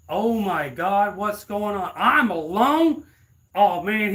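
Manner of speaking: high, slow, loud whimpering panic; hammed-up scared kid crying out; mock panic. Mock panic of nephew alone.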